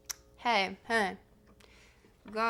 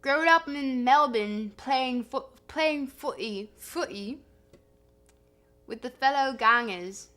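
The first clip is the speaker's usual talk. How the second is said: put-on Australian accent; 3 semitones higher, 8 dB louder than usual. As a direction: lay the Australian accent on thick. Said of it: "my Australian accent".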